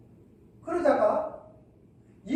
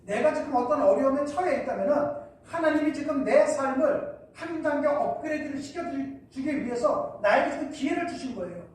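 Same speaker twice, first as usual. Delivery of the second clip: ordinary talk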